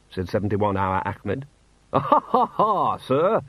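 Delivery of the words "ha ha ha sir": dutiful, high mirthless ha-ha; polite forced laugh. Acted. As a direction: laugh only because it's expected.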